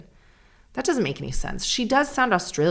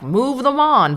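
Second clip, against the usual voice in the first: stern, raised demand; right-wing voice; 8 semitones higher, 5 dB louder, slower than usual. Pretend. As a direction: bark it like a hardliner. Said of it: "makes me sound incredibly right-wing".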